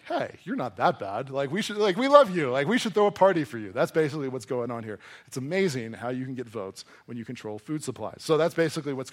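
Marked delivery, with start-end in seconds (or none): 0.0-3.7 lightly acted flattering crowd, rising higher and louder; mock pleading to win favour; fawning crowd. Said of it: "they're like".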